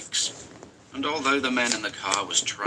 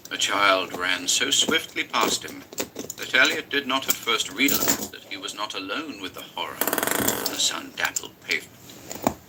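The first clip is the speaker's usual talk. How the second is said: ordinary delivery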